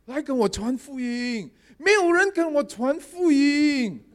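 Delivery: high, slow calling cry; dog calling out. Retold or imitated. Talking puppy calling out to the pastor, high slow cry. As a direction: call out slowly like the talking puppy. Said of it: "一路这样喊哦".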